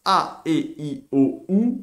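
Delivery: "A, E, I, O, U" as separate, distinct vowel sounds; each of "A, E, I, O, U" given its own beat; vowel demonstration. Shown as a demonstration. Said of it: "se prononce".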